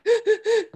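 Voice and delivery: laughing high gasp of delight; sharp excited intake of breath. Retold or imitated. Thrilled reaction to old man.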